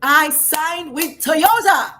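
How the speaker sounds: slow, proud reveal through a laugh; laughing with excitement. Real, not acted. Coach telling host about signing with Toyota, laughing proudly. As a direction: savor the big news, laughing as it comes out. Announcing big brand deal.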